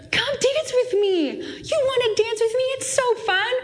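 put-on high, airy voice of a playful older woman; fun dance-floor lady. Retelling the dream lady inviting Jesse to dance, high and playful. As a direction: coax her to come dance, pitched high.

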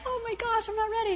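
high, soft, panicky little voice; panicked squeak. Retold or imitated. Put on the spot on set, a high panicked squeak. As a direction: squeak it out like stage fright. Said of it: "bad flashbacks sitting there being put on the spot".

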